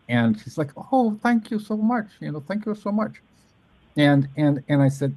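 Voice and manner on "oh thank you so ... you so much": lightly mock her gentle voice; high breathy shy poet impression; imitating Ellen. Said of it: "I'm not mocking you, Ellen".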